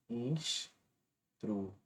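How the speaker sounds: "Instruídos" very slow and soft, the "í" stretched; slow syllabified demo. Shown as a demonstration. Teacher to students, "Instruídos" stretched and soft. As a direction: spell out "Instruídos" slowly, leaning on "í". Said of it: "Quando esse I ou U forma um hiato".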